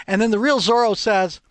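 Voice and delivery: usual speaking voice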